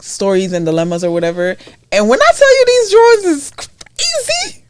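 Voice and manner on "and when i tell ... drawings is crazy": excited exclamation. Real, not acted. Friends reading a post, amazed at the drawings, excited squeal. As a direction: squeal it out high with disbelief.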